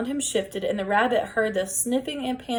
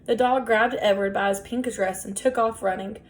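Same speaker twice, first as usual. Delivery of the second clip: ordinary delivery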